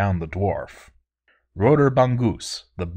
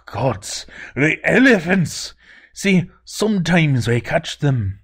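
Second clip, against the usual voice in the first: panicked Scottish dwarf; 7 semitones higher, 4 dB louder than usual. Acted. Dwarf panics about elephants.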